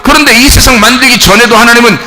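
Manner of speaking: high, loud sermon delivery building to a shout; full-force preaching. Real, not acted.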